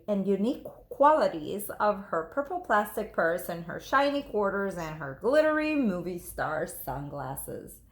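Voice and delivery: usual speaking voice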